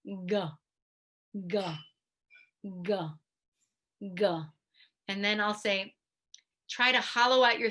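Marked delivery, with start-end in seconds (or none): 0.1-4.3 short g sounds pulsed from the back of the tongue; repeated g drill. Shown as a demonstration. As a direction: tap out g, g, g, g. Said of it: "So it looks like this".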